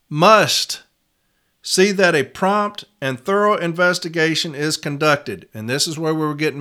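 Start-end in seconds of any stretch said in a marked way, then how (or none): 0.1-0.8 "must" alone between pauses, firmly pushed; stress on must. Shown as a demonstration. Reading the publication to reps, stressing "must" on its own. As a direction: isolate "must" and give it weight. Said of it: "always make sure that we circle that".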